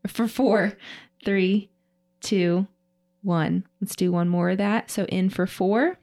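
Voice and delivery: slow, paced breathwork counting; slow breath counting. Shown as a demonstration. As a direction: count the breaths out slowly and evenly. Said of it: "we'll go ahead and inhale through the nose".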